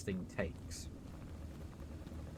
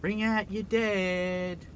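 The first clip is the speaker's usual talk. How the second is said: slow, drawn-out, higher mournful cry; Monty Python plague-cart cry; 8 semitones higher, 6 dB louder, much slower than usual. Retold or imitated. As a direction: call it slowly like a plague-cart collector. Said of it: "I think of Monty Python".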